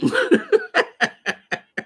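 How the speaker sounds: loud high cackle; high burst of laughter. Real, not acted. Streamer laughing at her own line.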